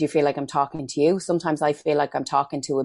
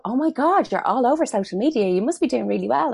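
much higher, mock-gushing street greeting; gushing acquaintance; 9 semitones higher than usual. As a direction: imitate an old friend gushing over you. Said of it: "they'd go".

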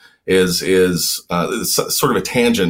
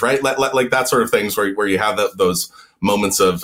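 ordinary talk

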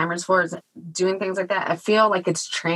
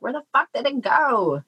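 pitched-up, incredulous question; mock disbelief; 7 semitones higher than usual. Parent waking up to find the kids grown, high disbelief. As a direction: throw it up in disbelief. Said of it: "you're like".

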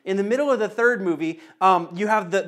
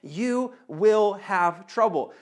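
slow quote; much slower than usual. Acted. Quoting Jesus slowly again.

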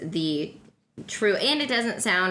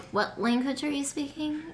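higher-pitched puzzled quote; mock bewilderment, pitched up; imitating baffled onlookers; 8 semitones higher, 3 dB quieter than usual. Druids answering the Texan intruder, baffled. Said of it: "They're all like".

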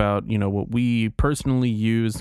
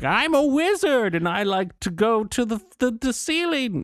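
Patton Oswalt nerd voice; 12 semitones higher than usual. Retold or imitated. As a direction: gush like a giddy nerd. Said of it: "Where he's like".

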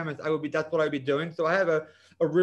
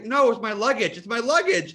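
insistent correction; 6 semitones higher, 6 dB louder than usual. Retold or imitated. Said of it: "I go".

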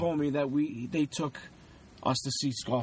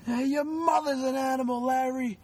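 angry shouting; 9 semitones higher than usual. Retold or imitated. Shouting match with the neighbor.

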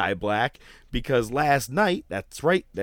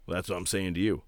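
character voice, plain take; 3 semitones lower, 5 dB quieter, faster than usual. Retold or imitated. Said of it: "do his character".

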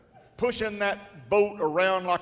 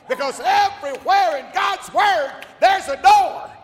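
high, loud shouted exhortation; preaching at full force; 8 semitones higher, 7 dB louder than usual. Acted. Preacher urging the congregation to dig, shouted preaching. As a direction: drive the church to prayer.

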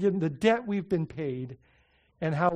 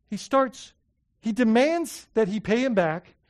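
ordinary talk, nothing marked

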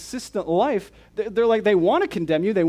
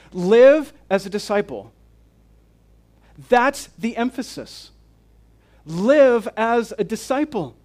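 full-force preaching; 5 semitones higher, 6 dB louder than usual. Acted. Preacher urging his congregation to live as disciples, full-force preaching. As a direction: drive each command home to the pews.

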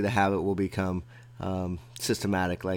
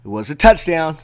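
excited; 6 semitones higher, 10 dB louder than usual. Retold or imitated. Triumphant sports story climax.

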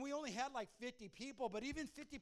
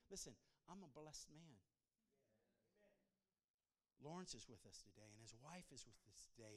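low, soft, earnest sermon appeal; hushed preaching; 6 semitones lower, 11 dB quieter than usual. Real, not acted. Preacher asking congregation not to miss today, hushed earnest appeal. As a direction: bring it right down and appeal gently.

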